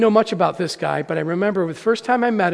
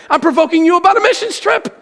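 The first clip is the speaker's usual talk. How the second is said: higher, louder playful crow; gleeful tease; 9 semitones higher, 5 dB louder than usual. Retold or imitated. A dad gleefully teasing his kids about a trip, higher and louder.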